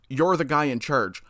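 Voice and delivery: normal talking voice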